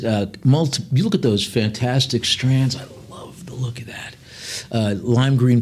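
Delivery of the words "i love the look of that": soft unvoiced whisper of delight; admiring whisper. Real, not acted. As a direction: breathe it out like a private admiration. Admiring aside during auction.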